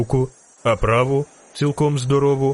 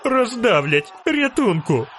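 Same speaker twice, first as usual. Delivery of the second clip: shrieking; 10 semitones higher, slower than usual. A narrator's reading. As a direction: squeal as if about to be trampled. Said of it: "верещав жовтець". (переляканий вереск високим голосом)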